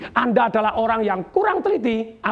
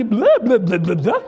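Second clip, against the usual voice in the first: clowning blurted "ble" syllables sung badly; mock off-key singing; 4 dB louder than usual. First-grader singing in class, badly off-key. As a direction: imitate a bad child singer on nonsense syllables. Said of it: "Waktu saya nyanyi".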